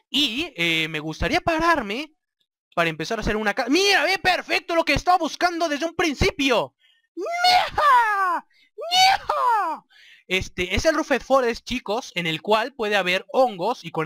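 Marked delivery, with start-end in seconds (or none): 3.7-6.7 high, louder excited exclaim; thrilled at finding it. Real, not acted. Finds the forest. 7.1-9.8 much higher, shouting cry; excited shout. Real, not acted.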